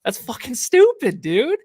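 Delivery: loud, high-pitched rant; furious at the coach's lineup call; angry outburst. Real, not acted. Co-host ranting about the lineup, a loud angry outburst.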